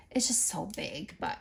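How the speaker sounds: soft unvoiced aside; whispering. Real, not acted. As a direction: whisper it to yourself. Aside about bulky packaging.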